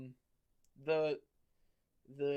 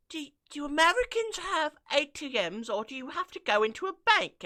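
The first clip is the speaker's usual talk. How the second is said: high, quick put-on British question; mock British accent; 10 semitones higher, 5 dB louder than usual. British TikToker asking about ATMs, high mock British accent. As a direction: mimic a British girl's silly question. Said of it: "then she was like".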